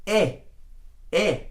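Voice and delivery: pure E as in elephant; a short clean E vowel; Spanish E. Shown as a demonstration. Teacher shows student the single Spanish E sound. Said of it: "You just pronounce it like this".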